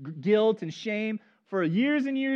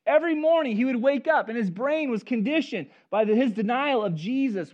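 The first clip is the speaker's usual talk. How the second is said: loud, high-pitched sermon push; preaching at full force; 6 semitones higher, 6 dB louder than usual. Acted. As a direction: preach it out to the back row, raised and driving.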